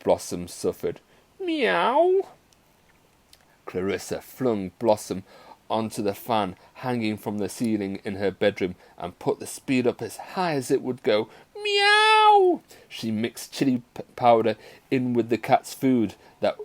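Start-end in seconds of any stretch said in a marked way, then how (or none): 1.4-2.3 very high-pitched pained mew; suffering kitten meow. A narrator's reading. Kitten suffering under loud music, high pained meow. 11.6-12.6 long, loud, very high meow; wailing kitten meow. A narrator's reading. Kitten spinning on the ceiling fan, loud wailing meow.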